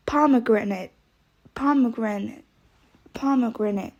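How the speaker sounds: ordinary delivery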